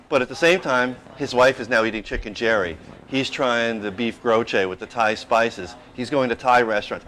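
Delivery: ordinary talk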